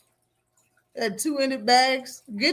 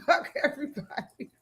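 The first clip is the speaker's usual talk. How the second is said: mock [name] defiance; 3 semitones higher, 6 dB quieter than usual. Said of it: "[name] acting like [name]".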